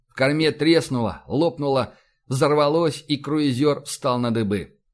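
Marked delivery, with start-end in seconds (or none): none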